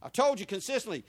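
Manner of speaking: ordinary talk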